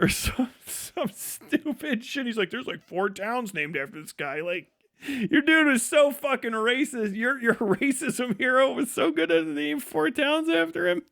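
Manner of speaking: normal talking voice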